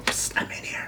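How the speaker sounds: sneaky whisper. Pretend. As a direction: pretend whisper from a hiding spot. Hiding in friend's room.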